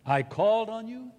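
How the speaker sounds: loud, high anguished call; anguished cry. Acted. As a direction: cry out to a hidden God. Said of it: "comes this poignant cry".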